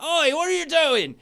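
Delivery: a caravan owner bellowing at intruders; angry shout. Acted. Caravan guy catches them.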